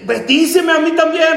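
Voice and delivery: high, impassioned cry for a blessing; imitating tearful pleading. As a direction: beg desperately, acted from the pulpit. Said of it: "con lágrimas".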